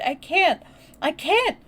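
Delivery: high, loud helpless wail; frantic high-pitched protest; sobbing. A narrator's reading. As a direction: cry it out in distress. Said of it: "he gasped and sobbed".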